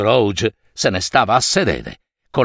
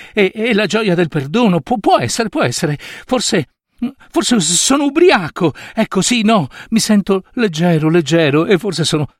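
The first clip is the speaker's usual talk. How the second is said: higher, stammering happy bewilderment; giddy joy; 6 semitones higher than usual. Acted. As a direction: tumble through the words, tipsy with joy. Scrooge stordito dalla gioia. (gioioso e confuso, quasi ubriaco)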